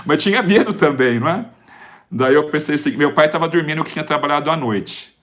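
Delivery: normal talking voice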